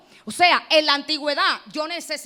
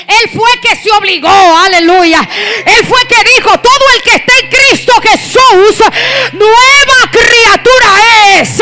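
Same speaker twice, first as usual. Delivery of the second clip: loud, drawn-out declarations shouted to the congregation; triumphant pulpit shout; full-force preaching; 3 semitones higher, 13 dB louder, slower than usual. Real, not acted. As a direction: shout each phrase like a victory, stretching the last words.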